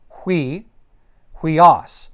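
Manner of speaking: "hui" as one syllable, hwee, then "huios"; "huios" built up from its opening hwee; initial diphthong. Shown as a demonstration. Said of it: "starts with a diphthong".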